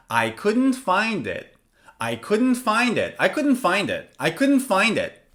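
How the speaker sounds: "I couldn't find it" starting high and dropping on "find it"; falling intonation. Shown as a demonstration. Teacher repeating a finished-action example, "find it" falling. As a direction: close it off with a fall on "find it". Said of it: "when something is finished and over".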